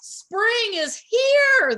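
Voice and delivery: high, loud, very slow shout; hoarse shouting. A narrator's reading. Groundhog shouting on and on, hoarse. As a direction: shout till the voice goes. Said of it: "kept shouting in a voice ... hoarse".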